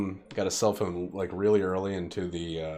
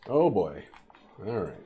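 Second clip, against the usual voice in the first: normal talking voice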